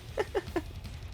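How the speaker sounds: quiet, breathy little laugh; soft chuckle. Real, not acted. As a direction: chuckle softly to yourself. Laughs after admitting sarcasm.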